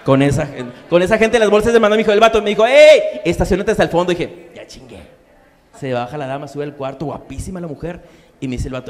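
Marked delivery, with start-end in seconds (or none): 2.7-4.1 loud call. Retold or imitated. Passenger gives order. 4.5-5.0 a soft, sly gloat to himself; under the breath, pleased; quiet gloat. Retold or imitated. His quiet inner thought.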